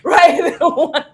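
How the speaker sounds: laughing as she asks right; a real laugh over the word; amused, laughing question. Real, not acted. Laughs at own joke.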